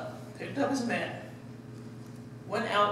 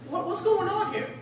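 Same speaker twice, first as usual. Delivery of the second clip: much higher, bewildered cry; startled crowd member; 10 semitones higher than usual. Retold or imitated. Crowd member startled by men breaking the roof, high bewildered cry. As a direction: sound caught off guard by the commotion. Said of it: "there was people amongst that crowd freaking out".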